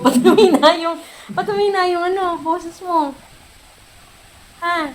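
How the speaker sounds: high-pitched teasing through real laughter; natatawang panunukso. Real, not acted. Amused teasing of pet Cloud. (natatawa, mataas ang boses)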